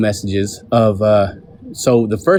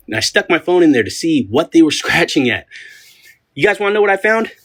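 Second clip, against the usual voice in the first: ordinary delivery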